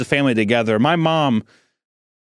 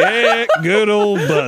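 higher, slow, laughing tease; teasing dad; 9 semitones higher, 3 dB louder, slower than usual. Retold or imitated. Dad teases his young son with a nickname, higher and laughing. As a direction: rib the kid with a chuckle. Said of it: "He's like".

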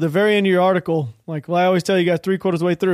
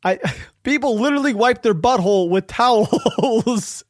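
laughing quote; 4 semitones higher than usual. Retold or imitated. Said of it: "her response was".

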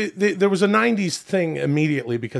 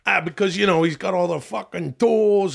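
higher-pitched Long Island loudmouth; Long Island regular giving his opinion; Long Island accent; 5 semitones higher than usual. Acted. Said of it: "that's a Long Island accent".

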